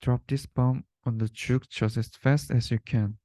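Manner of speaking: ordinary delivery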